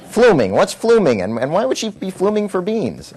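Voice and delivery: high, incredulous questions about the word; comic bewildered outburst; baffled disbelief. Retold or imitated. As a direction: exclaim in mock confusion at the word fluming. Baffled by wife's nonsense note.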